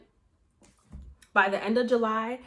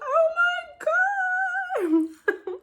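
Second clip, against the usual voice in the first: very high, drawn-out squeal near tears; overjoyed cry; 6 dB louder, much slower than usual. Retold or imitated. Told the apartment is hers, squealing with joyful tears. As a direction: let the joy break into a crying squeal. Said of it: "I was so fucking happy".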